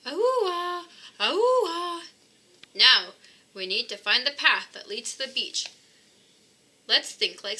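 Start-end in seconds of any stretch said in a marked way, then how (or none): 0.1-2.0 high whale cry; whale call. Acted. Calling to the baby whale so he knows they come, high call. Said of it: "Call with me".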